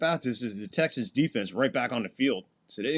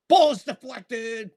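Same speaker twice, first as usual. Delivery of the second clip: louder and higher, slowing on the deflection; excited call; 8 semitones higher, 5 dB louder, slower than usual. Real, not acted. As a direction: punch out the deflection. Live play-by-play, pass deflected.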